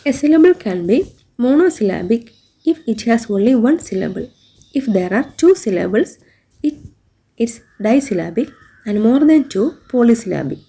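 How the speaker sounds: reads the textbook definition word by word, very slowly; slow dictation reading. Shown as a demonstration. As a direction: dictate it slowly so the class can write it down.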